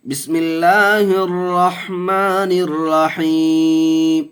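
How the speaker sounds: slow, high, drawn-out chanted recitation; Quranic recitation. Shown as a demonstration. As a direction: intone each syllable long and melodic like tajweed recitation. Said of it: "teacher recites Ikhlas".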